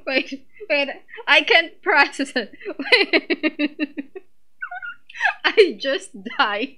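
words broken up by laughter; laughing. Real, not acted. Fan cracking up over the outfit, laughing through words. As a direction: laugh through it, barely able to speak.

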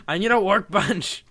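slow, loud, high wail; exasperated yell. Real, not acted. Gamer unable to find a workbench, exasperated yell. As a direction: cry it out in comic desperation.

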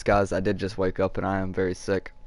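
usual speaking voice

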